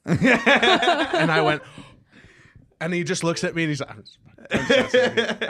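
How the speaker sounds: laughing. Retold or imitated. Retelling Nick's teasing reply.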